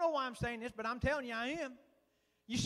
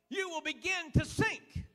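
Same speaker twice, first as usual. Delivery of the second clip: ordinary delivery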